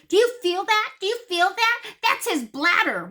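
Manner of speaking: high, loud questioning vet voice; vet explaining. Retold or imitated. Vet asking the owner to feel the cat's belly, high and pressing. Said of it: "She goes".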